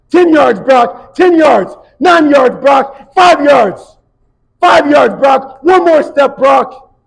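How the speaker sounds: loud, high shouted yard count; shouted countdown. Retold or imitated. Coach to Brock, shouting the yards down. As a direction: bellow each yard to get him there.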